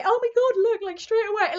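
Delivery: high, loud burst of delight; 1 excited gasp. Real, not acted. Tarot reader gasping at the card to viewers, excited.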